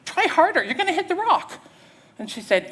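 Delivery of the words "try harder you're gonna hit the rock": high, loud warning from shore; urgent shout. Acted. Hiker to woman in raft, urgent high shout. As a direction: holler advice at the rafter. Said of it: "and he said".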